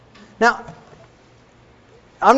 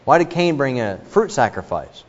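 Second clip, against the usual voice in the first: ordinary talk, nothing marked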